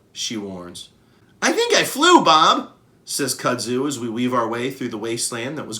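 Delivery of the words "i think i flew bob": put-on high, loud, bubbly child gorilla voice; a thrilled little kid after a ride. Baby gorilla Kudzu thanking the elephants, high and thrilled.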